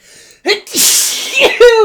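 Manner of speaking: sudden loud real sneeze. Real, not acted. Speaker interrupting her own talk, a loud real sneeze. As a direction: let the sneeze burst out. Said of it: "I'm gonna sneeze".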